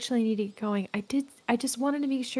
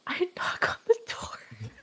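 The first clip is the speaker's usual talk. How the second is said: about to cry. Real, not acted. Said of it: "Sam's about to cry".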